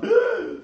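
loud, high gasp imitating scandalised listeners; mock shocked gasp. Preacher acts out listeners hearing cannibal talk, with a mock shocked gasp. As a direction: gasp in pretend horror. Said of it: "they would have gone".

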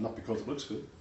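ordinary talk

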